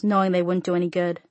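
usual speaking voice

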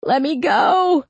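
high, slow, straining plea; desperate begging. A narrator's reading. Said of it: "Wright begged".

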